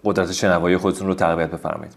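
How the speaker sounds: usual speaking voice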